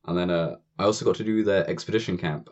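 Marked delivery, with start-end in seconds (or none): none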